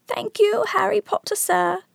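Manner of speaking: very high, happy squeak; Dobby. A narrator's reading. Elf thanking Harry, happily squeaking. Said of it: "squeaked Dobby happily".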